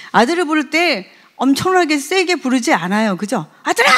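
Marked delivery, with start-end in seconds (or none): 3.6-3.9 sharp angry call to a son. Shown as a demonstration. Father calling his son in anger, sharp mock call. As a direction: snap the name out in anger. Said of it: "이건 화낼 때잖아요".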